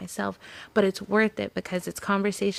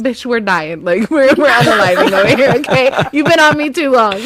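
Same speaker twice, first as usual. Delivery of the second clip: mock phone rant; 3 semitones higher, 12 dB louder than usual. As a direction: play the phone scolding her, half laughing. Voicing her phone, laughing.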